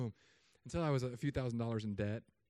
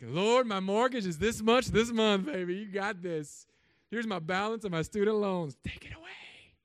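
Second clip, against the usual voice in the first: high, loud hammed-up call to heaven, breaking higher at the end; mock brash prayer; 7 semitones higher, 10 dB louder than usual. Pastor jokingly handing God his mortgage and loans, mock brash shout. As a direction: belt it out like a cocky, pretend prayer.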